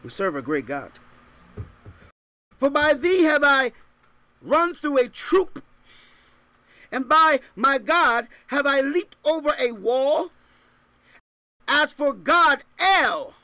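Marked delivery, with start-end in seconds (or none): 2.6-13.3 raised, ringing verse reading; scripture proclamation. Real, not acted. Preacher reads scripture to congregation, proclaiming it. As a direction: proclaim the verse high and strong.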